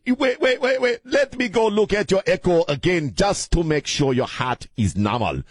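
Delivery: put-on very high, urgent African-accented doctor; mock African accent. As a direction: imitate a doctor halting everything, in an African accent. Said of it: "do a very racist accent".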